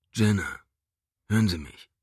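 deep baritone. Acted. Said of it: "der tiefe, rollende Bariton".

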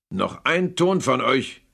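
growled threat. Acted. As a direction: snarl each word slowly as a warning. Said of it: "knurrte Scrooge".

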